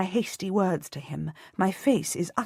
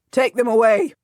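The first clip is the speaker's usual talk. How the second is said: loud, impatient command; repeated order, louder; 6 semitones higher, 8 dB louder than usual. A narrator's reading. Salisbury repeating his order, louder and impatient. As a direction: bark it out again, harder. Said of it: "Salisbury repeats".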